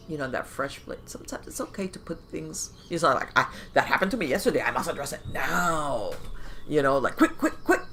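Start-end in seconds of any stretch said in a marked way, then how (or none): 3.4-6.1 rushed, laughing parody of urgency; mock urgency. Mimics someone who must react at once. 7.2-7.8 high, loud hurrying squeaks; high-pitched impatient chirping; mock urgency. Mimics hurrying before it fades.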